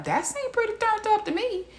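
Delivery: high, dry sarcastic aside; dry sarcasm. Retold or imitated. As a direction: say it like it's obvious. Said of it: "I'm just like".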